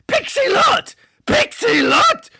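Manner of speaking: indignant wail; outraged disbelief. Real, not acted. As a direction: howl the name in outrage. Outraged at judge choice.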